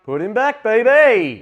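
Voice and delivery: loud, high cheer; excited cheer. Real, not acted. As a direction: shout it with glee. Cheering opponent's heal.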